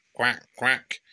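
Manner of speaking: lightly voiced duck quack. Mummy duck calling, light quacking.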